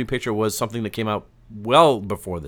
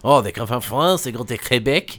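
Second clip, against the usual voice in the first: hammed-up French accent; put-on French voice. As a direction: tell it as a mock Frenchman. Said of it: "best French voice on for this".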